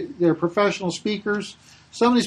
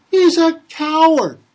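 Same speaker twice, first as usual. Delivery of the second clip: mock accusation; 8 semitones higher, 8 dB louder, slower than usual. Critics' accusation quoted.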